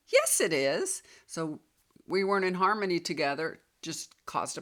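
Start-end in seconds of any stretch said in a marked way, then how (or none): 0.1-1.0 higher, emphatic contradiction; insistent body's voice. Retold or imitated. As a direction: argue back firmly as your body. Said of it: "My body is saying".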